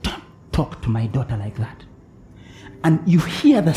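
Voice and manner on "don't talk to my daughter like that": soft, low, stern warning; quietly voicing the Spirit; God's whisper. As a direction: hush it like a divine rebuke. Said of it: "He just whispers".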